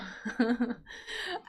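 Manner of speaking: soft chuckle; brief self-amused laugh; light laugh. Real, not acted. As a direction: laugh it off quietly. Laughs after the pronunciation detour.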